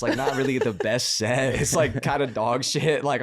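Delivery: ordinary talk, nothing marked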